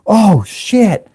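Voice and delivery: high, drawn-out "oh" of dismay; retold alarm. Driver feels the tank start moving, alarmed. Retelling his alarm as it moves.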